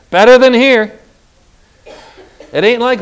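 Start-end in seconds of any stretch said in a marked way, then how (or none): 0.1-0.9 "Better" hit loud and high; emphatic preacher's lift on "Better"; stress on BETTER. Shown as a demonstration. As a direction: punch "Better" loudly, voice raised. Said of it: "let's accent the word better".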